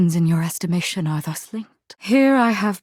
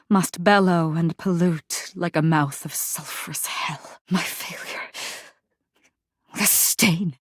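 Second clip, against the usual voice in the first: whisper. Acted. Whispered lament.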